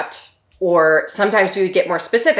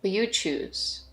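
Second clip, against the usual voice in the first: soft, unhurried meditation voice with long pauses; hushed, spacious meditation guidance; guided meditation; 2 semitones lower, 8 dB quieter than usual. Real, not acted. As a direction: gently guide them to settle and notice, leaving space between phrases.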